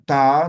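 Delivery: "ta" simple and loud; a clean "ta" with the vowel A; plain ta. Shown as a demonstration. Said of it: "Con A, para que sea fácil". ("ta" simple con A)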